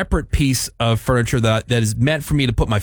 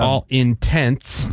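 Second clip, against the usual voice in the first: ALL INTENTS drawn out, word by word; slow correction; much slower than usual. Shown as a demonstration. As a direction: separate "intents" slowly and clearly. Correcting the misheard phrase.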